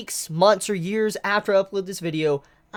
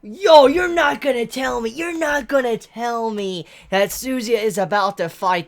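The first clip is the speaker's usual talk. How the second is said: higher and louder, amazed "yo" at the page; hyped disbelief; 6 semitones higher, 5 dB louder than usual. Real, not acted. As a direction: blurt it out in stunned excitement. Shocked reaction to manga panel.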